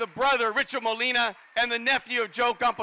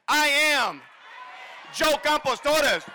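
louder, slower rallying shout; saying it louder; 4 semitones higher, slower than usual. Real, not acted. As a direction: belt it out so the family hears. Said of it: "let's say it louder so the heavens".

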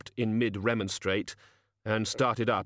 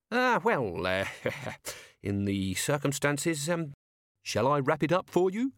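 higher, hesitant, nervous laugh; greedy toymaker caving; nervous shopkeeper; 5 semitones higher than usual. Acted. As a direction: shopkeeper fumbling to please. Shopkeeper gives in.

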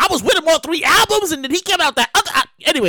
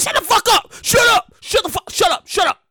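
mock snapping; 6 semitones higher than usual. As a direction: shout down the people rushing you. Snapping at people behind.

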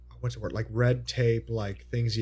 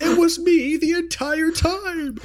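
laughing; 4 dB louder, slower than usual. Pretend. Mock punchline, slow and high.

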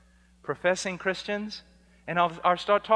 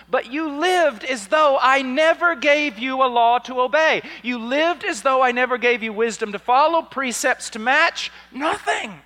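rising to a loud, high, ringing cry; preaching at full force; 8 semitones higher, 11 dB louder than usual. Acted. Preacher voicing Jesus to false disciples, roaring judgment. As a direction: thunder it out, building with every line.

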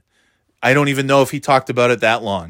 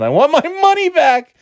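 much higher, mock-outraged fan; mocking complainer; 12 semitones higher, 4 dB louder than usual. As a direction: whine it like an aggrieved ticket buyer, mockingly. Mocks angry fans' quote from headline.

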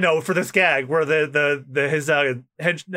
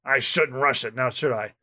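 low, soft, unhurried murmur; gruff navy captain muttering to himself; Smoker; 5 semitones lower, 3 dB quieter than usual. Acted. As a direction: talk to yourself like a cool-headed captain.

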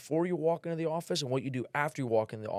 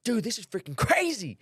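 excited outburst, higher and louder, imitating a starstruck salesman; fired up; 9 semitones higher, 5 dB louder than usual. Salesman recognizing a known customer, fired-up imitation. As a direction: act out a thrilled sales guy, pitch jumping up. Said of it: "He's fired up".

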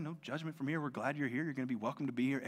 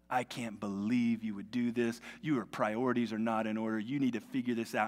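ordinary talk

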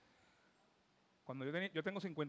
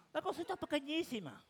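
tiny high voice; 11 semitones higher than usual. Shown as a demonstration. Illustrating how tiny the purse is.

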